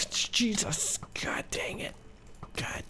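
hushed, voiceless cursing; exasperated whisper. Real, not acted. Player cursing while attacked, exasperated whisper. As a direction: curse under your breath.

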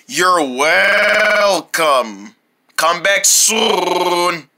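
very slow, drawn-out farewell; slow shopkeeper voice. Acted. Clerk saying goodbye to the boys, very slow. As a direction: drag the goodbye out like a sleepy clerk. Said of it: "talking like that makes me wanna gag".

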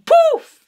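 a sudden high loud "poof"; playful burst of a sound effect; mock poof sound. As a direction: pop it out like a magic trick.

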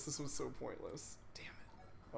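unvoiced frustrated whisper. Real, not acted. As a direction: whisper it under your breath. Muttered frustration at game.

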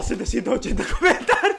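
words breaking up into laughter; exclaim, then crack up; laughing disbelief; amazed laughing. Real, not acted. Amazed by comment count, laughing.

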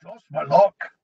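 weak dwarf voice. A narrator's reading. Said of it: "Mulch smiled weakly".

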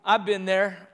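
normal talking voice

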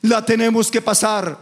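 preacher chanting over the music; fervent preaching. Acted. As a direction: lift it into a sung, rousing preach. Preaching builds to chanted climax.

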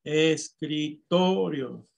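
escritorio slowly split, TO held long; stressed syllable shown. Shown as a demonstration. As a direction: dictate es, cri, then a long "to" in escritorio. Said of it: "¿Cuál es?".

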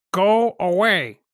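high, loud, slow squawk; loud seagull voice, words stretched. A narrator's reading. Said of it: "squawks Seagull".

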